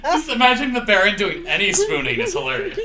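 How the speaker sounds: high, loud talking through laughter; helpless laughter spilling into the words; laughing hard. Real, not acted. Cracking up at spooning joke.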